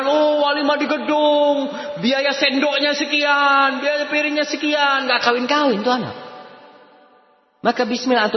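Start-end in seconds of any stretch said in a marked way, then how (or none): 0.0-6.1 high, slow mock talk of wedding costs; mocking fussy people. Preacher mocking people who fuss over wedding costs, high and slow. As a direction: lampoon the fussy planners. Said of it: "Orang kan mengatakan".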